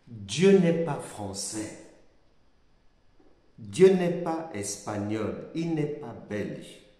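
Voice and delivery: ordinary talk, nothing marked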